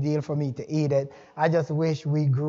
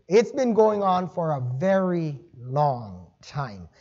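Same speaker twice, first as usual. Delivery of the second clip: ordinary talk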